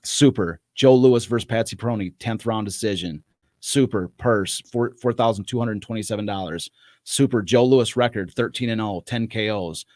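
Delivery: measured announcement of fight stats; caption read-out. Acted. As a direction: punch each heading, then let it fall. Reads on-screen captions from script.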